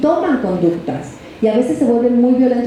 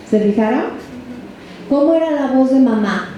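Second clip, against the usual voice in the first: ordinary talk, nothing marked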